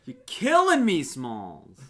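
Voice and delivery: loud New York movie quote; New York accent. Acted. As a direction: shout it New York style. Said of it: "you did a New York accent".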